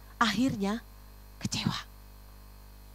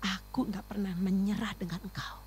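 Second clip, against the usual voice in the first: God speaking tenderly; 2 semitones lower, 10 dB quieter than usual. Retold or imitated. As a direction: speak softly as a father to his child. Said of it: "waktu Tuhan bicara dengan saya".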